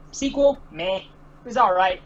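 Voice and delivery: ordinary delivery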